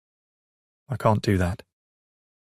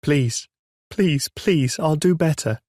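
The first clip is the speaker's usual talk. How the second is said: desperate pleading; 7 semitones higher, slower than usual. A narrator's reading. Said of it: "I scream in my head".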